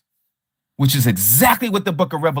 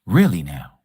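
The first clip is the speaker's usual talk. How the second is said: sarcastic; 13 semitones lower than usual. Acted. Sarcastic retort to opponent.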